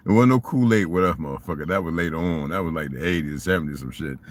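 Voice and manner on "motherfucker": ordinary delivery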